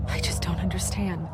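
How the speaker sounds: whisper. Acted. Grieving over a murder.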